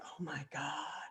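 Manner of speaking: soft, low, worn-out groan; drained dismay. Retold or imitated. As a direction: imitate an overwhelmed friend, barely audible. Overwhelmed friend, head on table.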